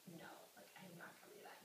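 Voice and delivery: breathy whispered brush-off; hushed mocking no; dismissive whisper. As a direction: whisper it like you can't be bothered. Sorority girls brushing off invite.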